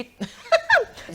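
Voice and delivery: short, loud high-pitched laugh; high giggle. Real, not acted. Telling the co-host a story, breaking into a high laugh.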